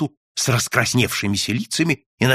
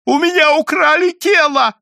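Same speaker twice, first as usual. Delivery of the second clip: high, loud cry of outrage; shrieked complaint; anguished shout; 7 dB louder than usual. A narrator's reading. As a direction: wail it out at the top of the pitch. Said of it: "закричал невидимый".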